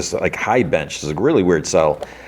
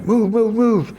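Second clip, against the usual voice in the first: high-pitched imitation of urgent orders; put-on high voice hustling people along; mock soldier commands; slower than usual. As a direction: imitate soldiers in a much higher voice. Voicing soldiers rushing in with orders.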